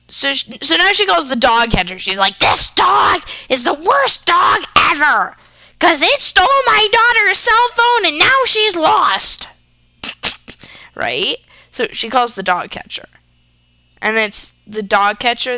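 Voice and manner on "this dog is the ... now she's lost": loud, high, hammed-up outrage; acted outraged complaint. Mom reporting the dog to the dog catcher, outraged yelling. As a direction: punch out the complaint in a drawn-out yell.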